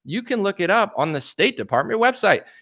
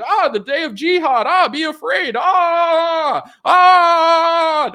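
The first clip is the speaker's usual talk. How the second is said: high shouted mock doom cries; hammed-up screaming doom-monger; mock fearmonger; 12 semitones higher, 6 dB louder, much slower than usual. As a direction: ham up a panicking pundit wailing doom. Mocking fearmongering pundits.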